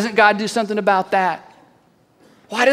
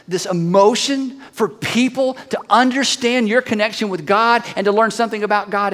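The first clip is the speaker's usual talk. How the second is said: ordinary talk, nothing marked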